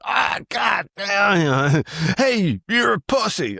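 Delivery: high, drawn-out mock taunt. Rednecks halt and jeer him.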